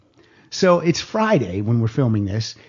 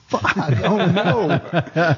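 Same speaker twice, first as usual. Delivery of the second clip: mock panic; 4 semitones higher, much slower than usual. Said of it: "I was like".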